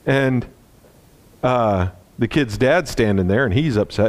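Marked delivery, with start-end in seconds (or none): none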